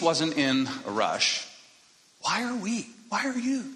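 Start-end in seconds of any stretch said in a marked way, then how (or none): 2.2-3.6 pointed high question. Real, not acted.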